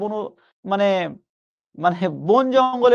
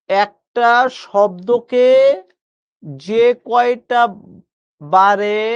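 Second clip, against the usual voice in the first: slow emphatic teaching; 4 dB louder, much slower than usual. Shown as a demonstration. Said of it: "আবার বলছি".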